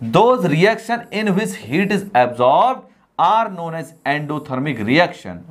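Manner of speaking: very slow, word-by-word dictation of a definition. Shown as a demonstration. Teacher dictating a definition to students, very slowly. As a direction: read it out slowly so the class can write it down.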